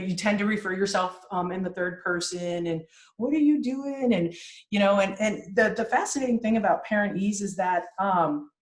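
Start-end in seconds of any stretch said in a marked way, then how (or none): 3.2-4.1 high exaggerated baby talk; parentese. Shown as a demonstration. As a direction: ask it like cooing to an infant. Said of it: "a high sing-songy".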